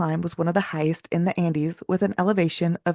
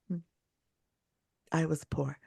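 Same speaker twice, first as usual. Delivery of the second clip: usual speaking voice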